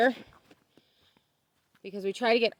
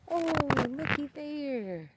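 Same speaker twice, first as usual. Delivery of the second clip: high, slow, drawn-out coo of discovery; delighted excitement; 7 semitones higher, 4 dB quieter than usual. Real, not acted. Gardener spotting new watermelons, cooing excitedly. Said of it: "That's so exciting".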